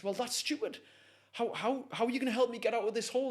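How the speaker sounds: high, rushed mock indignation; exasperated protest. Trapped man berates helper who brought nothing, exasperated. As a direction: act out incredulous frustration at a useless helper.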